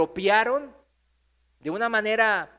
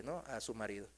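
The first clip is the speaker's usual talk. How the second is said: usual speaking voice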